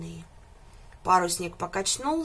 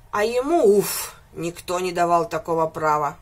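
louder on the opening, a mild mock bellow; bull's roar; 3 semitones lower, 6 dB louder than usual. A narrator's reading. Bull huffs about the goat, mildly roaring. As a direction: huff it like an offended bull. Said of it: "проревел бык".

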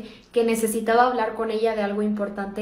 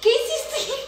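pregunta aguda entre risas; riendo; 12 semitones higher, slower than usual. Real, not acted. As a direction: suelta la pregunta riéndose. Sorprendida por el peinado, riendo. (riendo sorprendida)

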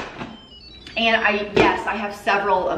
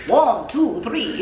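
Count counting; much slower than usual. Pretend. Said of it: "I feel like the count".

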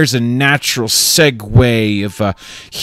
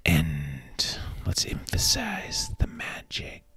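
whisper; 5 semitones lower, 13 dB quieter than usual. Acted. Whispered magic aside.

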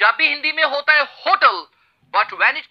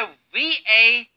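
slow letter-by-letter spelling; slow spelled letters; much slower than usual. Shown as a demonstration. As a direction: spell V-A-C-A-Y slowly, each letter apart. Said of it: "हमारा first word है".

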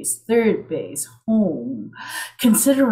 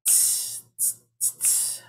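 soft unvoiced tss tss tss like a feathered cymbal; cymbal hiss; 14 dB quieter than usual. Shown as a demonstration. Poet imitating a drummer's cymbal for the crowd, soft hiss. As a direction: whisper the hi-hat rhythm. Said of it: "Your turn".

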